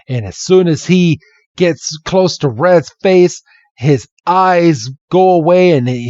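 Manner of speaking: ordinary talk, nothing marked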